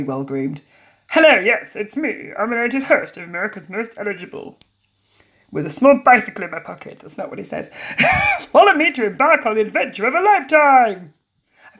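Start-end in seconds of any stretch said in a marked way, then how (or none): 1.1-7.0 lightly voiced TV host; game show host. Host introduces himself to fans, lightly hammed-up host voice. 8.5-11.1 louder, hammed-up presenter voice; game show host. Host leads fans to the mansion, hammed-up presenter.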